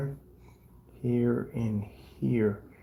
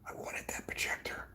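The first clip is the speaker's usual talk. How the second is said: whispered grumble. Retold or imitated. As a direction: whisper it like a sulk. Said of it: "Sometimes I get a little mad myself".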